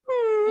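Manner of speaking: high whiny hum; pouty hum. Retold or imitated. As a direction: pout out a high little hmm. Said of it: "we were both just like".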